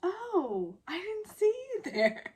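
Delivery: high, hammed-up fake surprise. Woman faking surprise at her crush, hammed-up high voice. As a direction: pretend you just noticed him, overplayed. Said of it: "sort of fakes the whole like".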